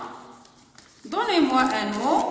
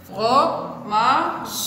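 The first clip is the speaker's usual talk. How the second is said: "Fromage" broken into fro, ma, ge; syllable by syllable. Shown as a demonstration. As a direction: sound out "Fromage" with gaps, voicing the final e. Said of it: "On a trois syllabes". (« Fromage » découpé en trois syllabes, fro-ma-ge)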